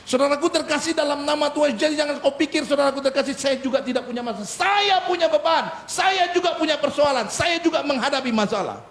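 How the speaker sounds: high, insistent preacher's voice; impassioned preaching. Acted. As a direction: preach it emphatically on a high pitch. Preaching at raised pitch. (khotbah tegas bernada tinggi)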